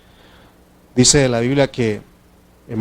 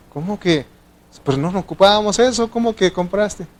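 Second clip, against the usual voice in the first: mock indignant husband; 7 semitones higher than usual. Husband quarrel over purchases.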